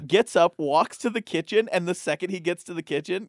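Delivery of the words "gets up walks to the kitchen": ordinary talk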